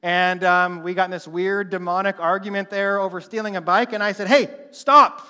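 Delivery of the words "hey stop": loud, higher sharp command; commanding shout. Retold or imitated. Preacher commanding the bike thief to stop. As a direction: bark stop with authority.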